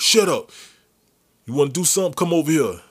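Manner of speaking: loud, high challenge; angry threat. Retold or imitated. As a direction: dare him to come closer. Uncle threatens the man.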